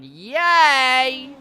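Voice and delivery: normal talking voice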